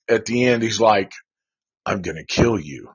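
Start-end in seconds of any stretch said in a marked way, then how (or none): 1.9-2.9 low, soft imitation of a threat; creepy caller. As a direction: quietly mimic a creepy phone caller. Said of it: "he's so creepy".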